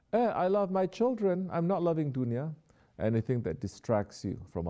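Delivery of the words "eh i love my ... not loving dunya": high, whiny mocking voice of a worldly believer; sarcastic imitation of an excuse. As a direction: parody someone excusing their love of the world.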